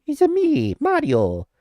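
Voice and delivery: quick high-pitched Mario Italian accent impression; a playful Italian accent aping Mario's catchphrase. Streamer to chat, showing off his Mario Italian accent. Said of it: "the only Italian accent I can do is Mario".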